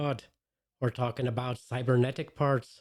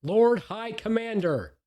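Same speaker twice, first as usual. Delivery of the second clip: slow, higher and louder, each word drawn out; grand drawn-out title; 9 semitones higher, 4 dB louder, slower than usual. Pretend. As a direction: proclaim the title like royalty. Grand title in self-introduction.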